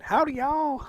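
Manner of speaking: high, put-on cowboy drawl "Howdy, y'all"; hammed-up Southern American howdy; mock American accent. Vietnamese guy faking an American, in a mock Southern accent. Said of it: "He said".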